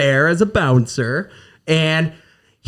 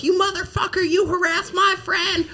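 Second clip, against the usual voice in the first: high shrieked mock accusation; angry girl; 13 semitones higher, 4 dB quieter than usual. Bouncer imitates the girl yelling at the groper, high mock shriek. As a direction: screech at him like the furious friend. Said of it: "some girl's like".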